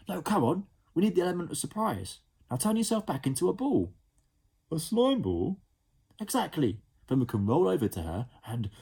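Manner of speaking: put-on a small boy pushing his plan; eager boy Ned. Ned voiced in story.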